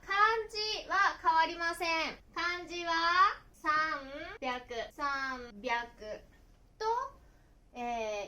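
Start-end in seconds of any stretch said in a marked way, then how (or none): 3.6-6.2 三百 slowly split as "san... byaku"; sanbyaku, drawn out. Shown as a demonstration. Teacher explaining that only the sound changes, slow san-byaku. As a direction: say 百 after 三 with a b, slowly. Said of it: "読みます".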